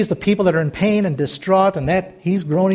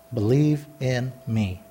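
slow, low and soft; low, measured, softly spoken refrain; hushed refrain; 6 semitones lower, 9 dB quieter, slower than usual. Real, not acted. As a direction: say it slowly and quietly, letting each word land.

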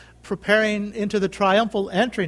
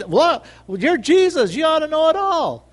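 mock indignant; 8 semitones higher, 4 dB louder than usual. Imagined indignant reply.

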